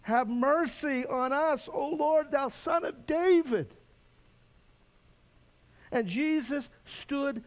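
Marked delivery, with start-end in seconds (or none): 0.0-3.7 "Have mercy on us" cried out high and loud, slow; louder cry. Retold or imitated. Beggars crying louder to Jesus despite the crowd, loud pleading. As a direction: cry it out all the more, pleading.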